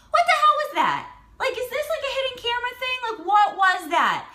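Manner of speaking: high-pitched, incredulous squeal of a question; bewildered disbelief. Retold or imitated. Woman suspecting a hidden camera prank, high-pitched bewilderment. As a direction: shoot way up high, baffled.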